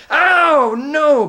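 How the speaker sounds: very high, loud howl of pain; yelping, full-voiced protest; bellow. A narrator's reading. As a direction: bellow in pained outrage. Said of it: "bellowed Trumpkin".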